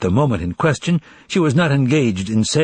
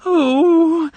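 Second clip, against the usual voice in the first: a long wailing howl; 5 dB louder than usual. A narrator's reading. Secretary breaking down in front of the bookkeeper, howling. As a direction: let out a despairing wail. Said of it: "howled Anna Richardovna".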